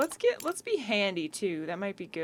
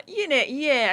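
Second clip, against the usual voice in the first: casual Boston accent; 5 semitones higher, 6 dB louder than usual. Real, not acted. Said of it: "I said that like a Boston accent".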